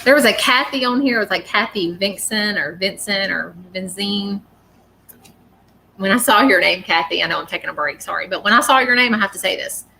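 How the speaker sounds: ordinary delivery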